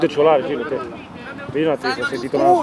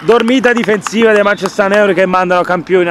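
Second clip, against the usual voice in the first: goal commentary; 7 semitones higher, 6 dB louder than usual. Acted. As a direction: stretch the scorer's name twice. (telecronaca del gol)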